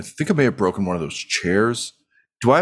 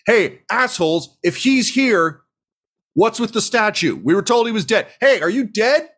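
brash innkeeper; 12 semitones higher than usual. Acted. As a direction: challenge the crowd bluntly. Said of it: "she just says".

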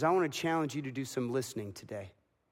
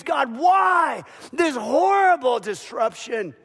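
loud, high, slow cry of anguish; anguished scream; 8 semitones higher, 8 dB louder, slower than usual. Retold or imitated. Mary at the cross crying out to God, loud anguished scream. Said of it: "she screams".

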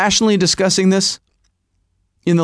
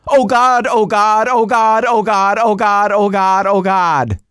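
higher-pitched mock wail, repeated without a breath; mock panicked screaming; 5 semitones higher than usual. Husband imitates wife as the desk topples, mock panicked screaming. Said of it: "immediately launches into screaming".

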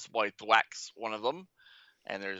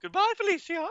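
much higher mock-sassy send-off; sassy goodbye Felicia; 3 dB louder than usual. Host hams up the meme for cohost, much higher sassy voice. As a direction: toss it off like a diva brushing someone away. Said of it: "I thought she was gonna give us a goodbye, Felicia".

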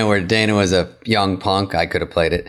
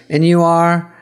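snooty, pitched-up question; haughty receptionist; 7 semitones higher, 3 dB louder, slower than usual. Retold or imitated. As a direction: look down on him. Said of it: "She goes".

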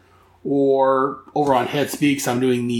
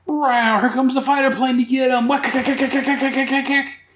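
much higher, excited voice with mock gun noises; high excited Julie voice; gleeful comic sidekick; 11 semitones higher than usual. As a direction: squeal like a cartoon sidekick, rattling cack cack.

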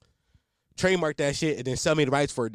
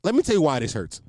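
ordinary talk

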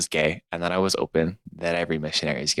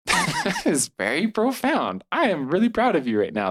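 mock gushing; 12 semitones higher than usual. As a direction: gush like a proud parent, playfully. Narrator praising companion, mock gushing.

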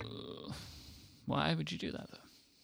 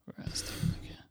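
whispering; 14 dB quieter, faster than usual. Real, not acted. Searching, muttering to self.

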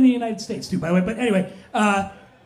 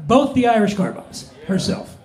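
ordinary talk

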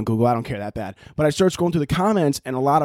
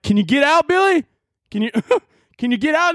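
high, raised mock call; taunting parent; 11 semitones higher than usual. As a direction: call to a trapped kid in a hammed-up high voice. Acts parent taunting kid in dryer.

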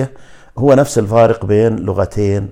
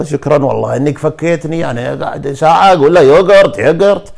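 imitating a customer's relieved complaint, pitched up; raised-pitch retold grumble; mock exasperated gratitude; 5 semitones higher, 5 dB louder than usual. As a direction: act out thanks after long frustration. Retold customer thanks his rescuer.

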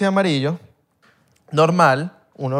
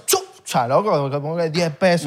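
ordinary delivery

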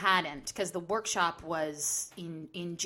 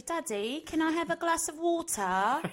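put-on a child's English accent, pitched much higher; 9 semitones higher than usual. Daughter asking her English dad for water, high English accent. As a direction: ask daddy sweetly in an English accent. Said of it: "speak to him in an English accent".

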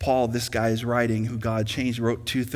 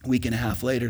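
ordinary talk

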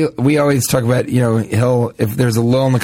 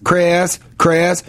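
higher, Southern-twanged Chris; fake-name Chris; 6 semitones higher than usual. Shown as a demonstration. Comedian repeating his fake Chris in a Southern twang. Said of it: "like I say the fake Chris's".

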